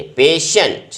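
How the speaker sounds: पेशेंट said crisply as "payshent"; teacher models पेशेंट clearly, stressing the first syllable; पेशेंट spoken slowly and deliberately; model pronunciation. Shown as a demonstration. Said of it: "इसका pronunciation मैं आपको बार बार कह रहा हूँ".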